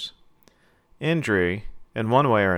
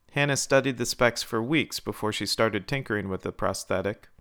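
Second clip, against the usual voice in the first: plain reading voice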